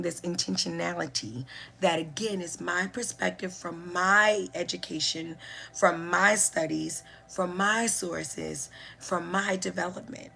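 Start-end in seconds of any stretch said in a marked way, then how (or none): none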